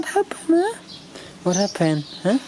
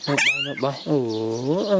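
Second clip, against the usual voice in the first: normal talking voice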